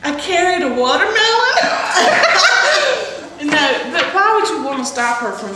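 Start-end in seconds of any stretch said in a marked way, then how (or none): 0.0-1.2 giggling through the quote, pitched up; quoting Baby while breaking into laughter; laughing movie quote. Retold or imitated. Friends trading Dirty Dancing lines, laughing quote. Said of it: "Baby said to Johnny". 1.2-3.4 a high squealing laugh; high burst of laughter. Real, not acted. Friend cracks up after quoting Dirty Dancing, squealing laugh.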